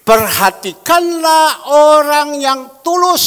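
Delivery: loud, slow proclamation; full-force sermon reading; preaching at full force. Acted. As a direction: boom the verse out to the congregation. Proclaims the scripture verse.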